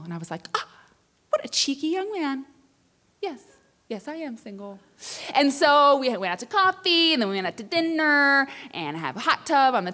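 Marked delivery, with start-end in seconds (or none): 0.5-4.8 coy flirt.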